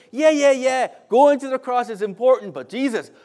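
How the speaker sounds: loud, high dismissive tempter, lightly voiced; tempting devil. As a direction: brush it off as a preacher's mock devil. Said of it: "The way he tempted Jesus".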